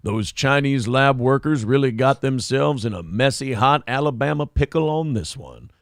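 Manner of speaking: lightly voiced Southern narrator accent; a hint of a Dukes of Hazzard Southern accent; Southern good old boy. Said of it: "Just a good old boys".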